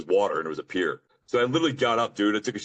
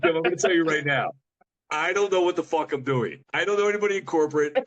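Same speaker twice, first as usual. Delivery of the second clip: exasperated confession; 8 semitones higher than usual. Retold or imitated. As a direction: blurt out the truth to the teacher. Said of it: "I was like".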